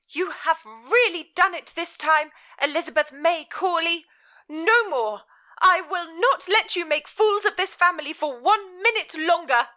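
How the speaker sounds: high, loud, scolding mother; furious mother. Acted. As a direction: lay down the law, outraged. Mother's voice in story.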